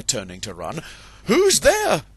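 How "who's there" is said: very high, loud alarmed cry; high frightened call; startled rooster. Acted. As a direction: jump at a hidden fox.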